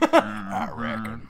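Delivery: slow, soft mock repeat of reckon; quiet teasing echo of a villain line. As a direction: drag the word out, teasing. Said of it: "mocking the villain's word".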